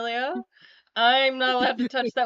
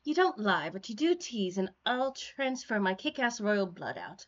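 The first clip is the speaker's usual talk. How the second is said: lightly voiced character, measured delivery; in-character script reading; 2 semitones lower, 7 dB quieter than usual. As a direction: read the character's complaint with deliberate phrasing. Reads a character's lines in the script.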